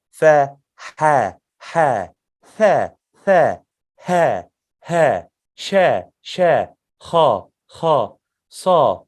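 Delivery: each letter with air pushed out: fa, ha, tha, sha, kha, sa, ka, ta; breathy letter drill. Shown as a demonstration. Teacher drills the whisper letters for students. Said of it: "The air must come out".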